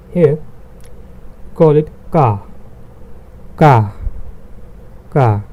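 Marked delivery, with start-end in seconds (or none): 2.1-5.4 ka said as long "kah"; ka as the German name of K, "kah"; German letter K. Shown as a demonstration. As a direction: say ka with an open "ah". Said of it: "written it K A H".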